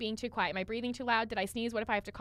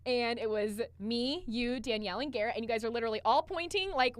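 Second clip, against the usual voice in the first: ordinary talk, nothing marked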